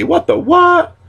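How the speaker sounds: sudden very high squeal; high-pitched mock outrage; squeaky catchphrase. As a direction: shoot the voice way up into a squeak. Catchphrase shrieked as a comeback.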